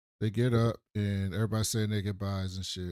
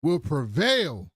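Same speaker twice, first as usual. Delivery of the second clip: slow, drawn-out and solemn, a touch louder; slow grand declaration; 3 dB louder, slower than usual. Retold or imitated. Mark vowing to Eve that love wins, slow and solemn. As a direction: lay out each word like a grand vow.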